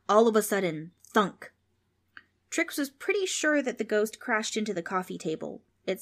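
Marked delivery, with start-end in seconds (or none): none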